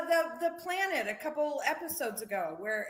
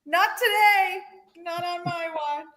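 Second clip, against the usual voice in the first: a higher, louder defiant declaration; heroic girl quote; 8 semitones higher, 8 dB louder, slower than usual. Retold or imitated. Fan retelling the girl's axe rescue in a higher, louder heroic voice. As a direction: play a plucky girl daring anyone.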